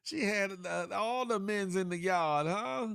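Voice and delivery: high, lively gossiping; excited gossip. Real, not acted. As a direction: spill the tea excitedly. Excited gossip about Forty.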